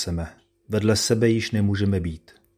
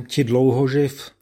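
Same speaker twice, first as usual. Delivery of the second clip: slow, higher and louder, torn between sorrow and anger; grieved angry cry; 5 semitones higher, 5 dB louder than usual. A narrator's reading. Old father to his ungrateful son, grieved angry cry. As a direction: cry it out slowly, hurt and furious. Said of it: "zvolal otec hnut lítostí, hněvem".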